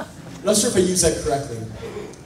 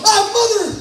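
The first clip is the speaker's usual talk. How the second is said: high yelled minced curse; mock yell; 8 semitones higher, slower than usual. Startled man yelling a fake curse at the intruder at his door. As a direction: blurt it out high like a startled yelp. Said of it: "and I yell".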